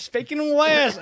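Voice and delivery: slow, high, stretched Southern drawl; hammed-up Georgia country drawl; country accent. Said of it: "I can turn my country accent on".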